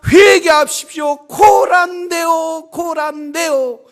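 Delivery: loud, high, sustained prophet's shout; crying out a warning. Acted. Noah warning the people to repent before the flood, crying out. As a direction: shout it out to a crowd, stretching the last cries. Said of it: "이렇게 외칠 때".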